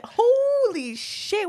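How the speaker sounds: high, slow holy shit; astonished, drawn-out exclamation; drawn-out amazement. Real, not acted. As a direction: stretch it out in shock. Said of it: "surprise at bottle".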